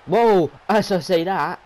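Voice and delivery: loud, high whoa; surprised. Real, not acted. Commentator caught out right after his prediction, loud and surprised. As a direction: caught out by the move.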